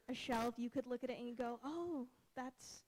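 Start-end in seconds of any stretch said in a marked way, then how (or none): none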